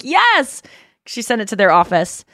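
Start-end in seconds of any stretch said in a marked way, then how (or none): 0.0-0.6 eager. Retold or imitated. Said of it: "I'm like".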